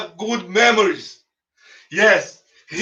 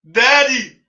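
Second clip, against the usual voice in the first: put-on child calling; 4 semitones higher, 4 dB louder than usual. Kids call their dying father.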